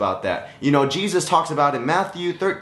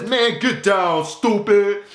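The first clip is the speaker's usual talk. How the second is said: slow mocking put-down voice; the weeds shoving the sprout down; lightly voiced mean vine; bullying weeds; 3 semitones higher, slower than usual. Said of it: "it says".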